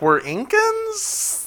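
unsure con man. Retold or imitated. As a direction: ask it like he's making it up. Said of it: "they were like".

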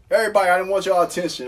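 loud, high, fast retold announcement; mock courtroom call for attention. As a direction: imitate a judge addressing the whole room. Judge calls the courtroom to attention.

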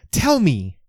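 high, loud booming command; booming demand. Acted. Leisha demanding an answer from Lewis: booming shout. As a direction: make it boom across the room. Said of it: "her voice booms across the apartment".

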